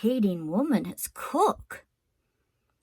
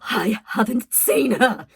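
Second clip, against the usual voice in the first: shouting; 2 semitones higher, 6 dB louder than usual. A narrator's reading. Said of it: "Louise was shouting".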